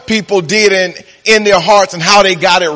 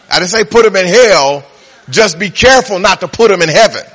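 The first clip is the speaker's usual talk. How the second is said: normal talking voice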